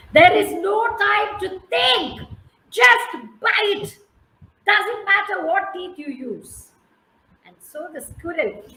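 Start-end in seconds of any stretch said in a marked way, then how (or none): 0.1-6.7 much higher and louder, angry shouting frog. Acted. Frog shouting at the dithering squirrel, angry. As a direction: yell at him to hurry. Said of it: "is getting angry and he said".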